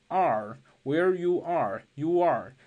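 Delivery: where you are said slowly with a w glide slipped in before are; linked where you are. Shown as a demonstration. Teacher slowly modeling where you are with a w glide. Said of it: "加插入一个半元音".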